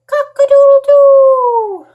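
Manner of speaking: high, loud rooster crowing. Pretend. Teacher showing children what a rooster's crow sounds like, loud and high. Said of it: "crow is the sound the rooster makes".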